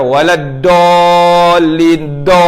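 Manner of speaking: "Waladolin" chanted long and held, with a plain d; faulty recitation of "Waladolin", vowels stretched; dad turned to dal. Shown as a demonstration. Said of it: "Tak ada pantulan tepi kanan kiri". (baca "Waladolin" panjang dengan bunyi dal)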